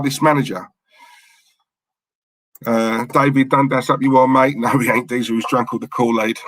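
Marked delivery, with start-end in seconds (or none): none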